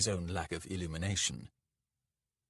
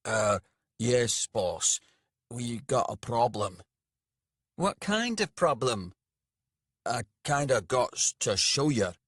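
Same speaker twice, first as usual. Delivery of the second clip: a plain-spoken servant voice, a bit louder; dim servant; 4 semitones higher, 5 dB louder than usual. A narrator's reading. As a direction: driver telling his boss to get out.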